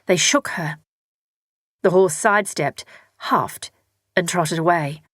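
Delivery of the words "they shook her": plain reading voice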